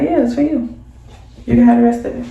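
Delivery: lightly voiced aunt; auntie voice. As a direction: voice the aunt handing over the blunt. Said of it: "Your auntie like".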